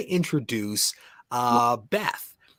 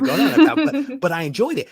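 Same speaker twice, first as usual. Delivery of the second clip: higher, laughing through the words; amused laughing; 12 semitones higher, 6 dB louder than usual. Real, not acted. Host enjoying the guest's unexpected answer, amused laughing. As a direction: talk through a real laugh.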